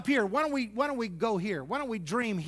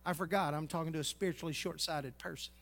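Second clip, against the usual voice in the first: sarcastic aside; 4 semitones lower, 6 dB quieter than usual. Retold or imitated. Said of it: "I'm thinking".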